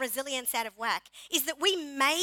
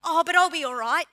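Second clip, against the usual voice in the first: mock reassurance; 7 dB louder than usual. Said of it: "at the end we go".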